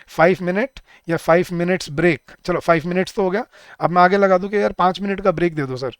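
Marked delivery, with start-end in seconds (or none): none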